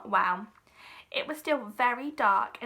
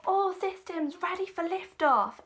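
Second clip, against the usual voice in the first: announcing; 7 semitones higher than usual. A narrator's reading. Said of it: "announced Marcel".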